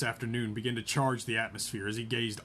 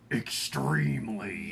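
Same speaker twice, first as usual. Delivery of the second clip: slow words through gritted teeth; through clenched teeth; much slower than usual. Acted. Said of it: "He spoke between clenched teeth".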